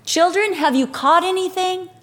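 high-pitched call across water; mild distant hail; calling from shore. Retold or imitated. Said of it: "Jesus shouts from the shore and says".

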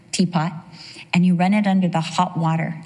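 usual speaking voice

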